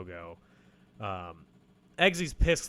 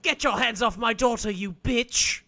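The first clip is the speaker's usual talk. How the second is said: high, louder British mum scolding; Molly Weasley impression; 9 semitones higher, 6 dB louder than usual. Friend mimics the host's Molly Weasley accent, high and loud. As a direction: snap at her like Mrs Weasley. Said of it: "I sound like Molly Lee Weasley".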